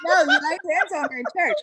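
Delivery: fast, loud, laughing reassurance. Real, not acted. Host reassuring guest while laughing loudly. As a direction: blurt it out through a laugh.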